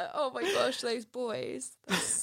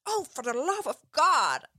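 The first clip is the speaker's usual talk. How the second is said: mock pain; 5 semitones higher, 3 dB louder than usual. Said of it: "she's like".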